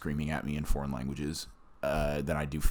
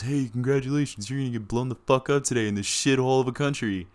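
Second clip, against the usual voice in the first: buddy's taunt; 9 semitones higher, 5 dB louder than usual. Retold or imitated. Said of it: "and he goes".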